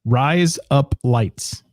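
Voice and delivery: each word drawn out very slowly; slow demo. Shown as a demonstration. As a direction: lay it out slowly so they can copy it. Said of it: "Do it right now".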